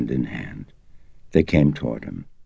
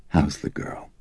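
somber; 4 dB quieter than usual. A narrator's reading. Detective asking the priest about the girl, somber and hushed. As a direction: ask quietly, heavy with concern. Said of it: "somberly asked".

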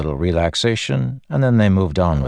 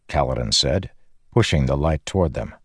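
ordinary delivery

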